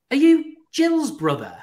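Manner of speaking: high, loud, slow incredulous question; retold amazed recognition. Lad meeting a friend's brother abroad, amazed. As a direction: imitate someone astonished to recognise an acquaintance.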